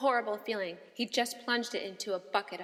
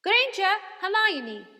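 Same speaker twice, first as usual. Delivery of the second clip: loud, high roll call; teacher reading out a list loudly; calling out names; 9 semitones higher, 7 dB louder, slower than usual. A narrator's reading. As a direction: call the name across the hall. Name called out.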